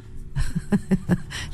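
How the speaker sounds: breathy unvoiced chuckle; soft breathy giggle at the absurdity; airy laugh. Real, not acted. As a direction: laugh it off under your breath. Host laughs mocking other radios.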